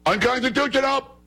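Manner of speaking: very high caricature protest cry; hammed-up screech, mocking the other side; mock outraged yelling. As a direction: squawk it like a hysterical objector. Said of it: "That's what they sound like".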